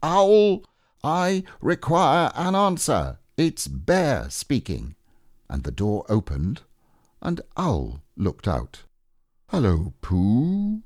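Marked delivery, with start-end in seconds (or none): 0.0-5.0 high, loud storybook call. A narrator's reading. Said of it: "called out in a very loud voice". 9.5-10.8 slow, higher drawn-out greeting; lightly voiced Owl. A narrator's reading. Owl greets Pooh in story.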